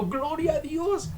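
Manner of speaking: high, slow, soft cry of praise; Paul blessing his weaknesses, high and drawn out; joyful praise. Retold or imitated. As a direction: lift it up like Paul rejoicing. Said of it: "bendijo casi que sus debilidades y dijo".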